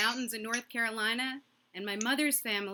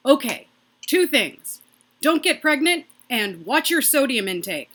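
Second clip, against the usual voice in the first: blunt dad; 4 semitones higher, 8 dB louder than usual. Retold or imitated. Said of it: "And he said".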